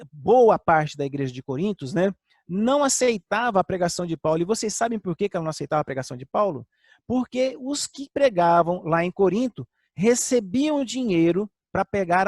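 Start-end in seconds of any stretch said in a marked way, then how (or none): none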